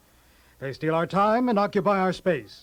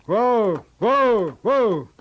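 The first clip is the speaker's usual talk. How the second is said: loud, high-arched calls; calling out whoa; 6 semitones higher, 5 dB louder, much slower than usual. Acted. Calling a halt aloud, loud calls. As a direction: call out each whoa, rising then falling.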